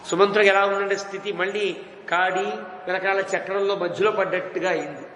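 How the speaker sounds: normal talking voice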